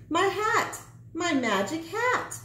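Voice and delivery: high, panicked gnome crying out; a gnome in sudden alarm, pitched high. A narrator's reading. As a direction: cry out for his shrinking hat. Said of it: "cried the gnome".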